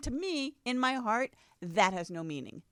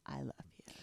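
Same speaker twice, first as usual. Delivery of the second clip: whisper; 7 semitones lower, 14 dB quieter than usual. Retold or imitated. Mock whispered love declaration.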